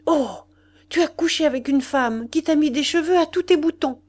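high, loud outcry of shock, pale and trembling; cried out. A narrator's reading. Mistress finding strange hairs on his waistcoat, crying out high and loud. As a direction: she blurts it out, suddenly stung. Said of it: "elle s'écria".

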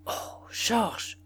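whispered, stunned reproach; shocked whisper. Acted. Wife stunned by Duroy's insult, whispering. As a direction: breathe his name in shock. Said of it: "et murmura".

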